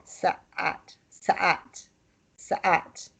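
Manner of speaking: Sa'at squeezed in the throat, softly; strangled ain. Shown as a demonstration. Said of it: "strangling it or squeezing it".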